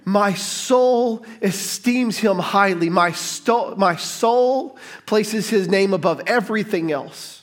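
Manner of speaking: Mary's prayer spoken slowly, soul stressed; slow reverent prayer. Pretend. Pastor voicing Mary's prayer to the church, slow and reverent. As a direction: draw out soul, esteems, highly with reverence.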